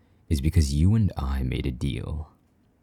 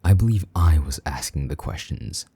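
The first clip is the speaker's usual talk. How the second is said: ordinary talk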